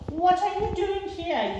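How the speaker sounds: put-on high little girl voice; girl teasing the dog; cheeky child. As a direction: tease the dog lightly. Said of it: "Small to the dog".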